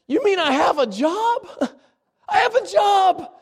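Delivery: high, laughing, tearful exclaim; thankful. Retold or imitated. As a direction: laugh through near tears of gratitude. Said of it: "And he's so thankful".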